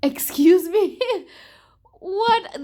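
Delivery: loud, high disbelieving "Excuse me? What?"; incredulous outburst. Real, not acted. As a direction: blurt it out in shocked disbelief, laughing. Outraged at plot twist.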